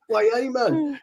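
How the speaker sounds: very high-pitched Geordie call; Geordie accent. Acted. Repeating the catchphrase for laughs, high Geordie accent. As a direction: do a squeaky-high Geordie greeting. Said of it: "Geordie's like".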